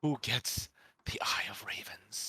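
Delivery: whisper. Real, not acted. Conspiratorial whisper over loot.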